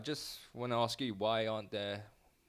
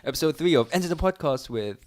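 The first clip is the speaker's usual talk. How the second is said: hyped-up announcement; 4 semitones higher, 13 dB louder than usual. Acted. Announcing the podcast intro.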